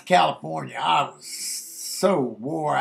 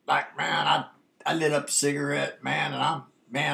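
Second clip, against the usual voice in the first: ordinary delivery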